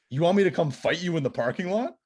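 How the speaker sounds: re-enacted astonished comeback; incredulous. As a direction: act out disbelief at the challenge. Retold reply to a fight challenge.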